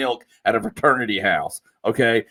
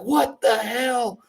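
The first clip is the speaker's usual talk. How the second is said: retold cussing; much slower than usual. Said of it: "he's just cussing".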